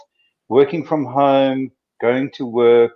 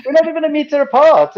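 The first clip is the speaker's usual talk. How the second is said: high, louder insistence on not; raised retold warning; 5 dB louder than usual. Man on sidewalk warning passers-by, raised insistent voice. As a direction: snap it out at people on the sidewalk. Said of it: "Twice I said".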